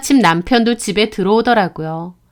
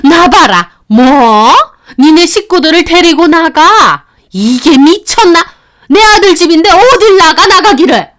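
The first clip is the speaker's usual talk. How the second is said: much higher and louder, shrieking in rage; furious shout; 6 semitones higher, 6 dB louder than usual. Acted. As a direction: explode at being told to leave. Mother-in-law yelling at daughter-in-law. (악을 쓰는 시어머니)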